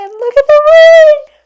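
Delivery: high, loud delighted squeal; shrieking with excitement as the box opens; excited squeal. Real, not acted. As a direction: squeal with joy at the ring. Opening the ring box on camera.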